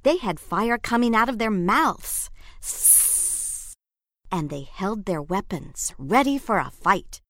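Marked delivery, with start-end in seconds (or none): 2.6-3.7 unvoiced hiss through the teeth; sharp hiss; monster hiss. A narrator's reading.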